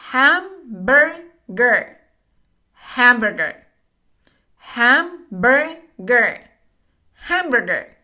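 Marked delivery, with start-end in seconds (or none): 0.1-2.0 Hamburger stretched out very slowly, each syllable separate. Shown as a demonstration. Teacher to Spanish-speaking learners, modelling Hamburger very slowly. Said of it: "pronunciation teacher models word". 4.6-6.5 Hamburger stretched out very slowly with a clear h; slow syllable by syllable. Shown as a demonstration. Teacher to learners, a slow careful Hamburger. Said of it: "pronunciation teacher models word".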